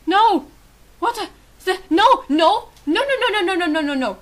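loud, high no after no; panicked denial. Real, not acted. Player refuses the shooting, panicked high denial. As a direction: cry out in disbelief, tumbling over the words.

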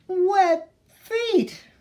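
put-on high, loud childish wail. Baby duck complains about her wet feet, wailing high and loud. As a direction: let the wail rise up and out. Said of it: "wailed Baby".

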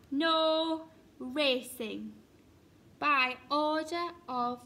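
loud, drawn-out official notice; bossy proclamation. A narrator's reading. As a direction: read out a stern rule. Duck's sign read out officiously.

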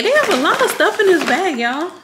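bubbling over at how much is in there; excited. Real, not acted. Telling viewers about the full gift bag, excited and fast.

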